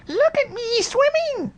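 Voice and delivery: very high squeaky voice; a proud little joey squeaking; squeaked. A narrator's reading. Little Roo shows off in the water, very high squeak. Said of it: "squeaked Roo".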